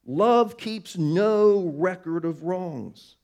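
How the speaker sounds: each word drawn out slowly; a slow, weighty scripture line; slow emphatic verse. Acted. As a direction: lay the verse down slowly and firmly.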